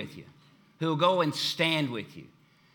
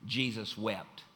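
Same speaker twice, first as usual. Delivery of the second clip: solemn hush; 5 semitones lower, 8 dB quieter, slower than usual. Acted. Shortest verse, solemn reading.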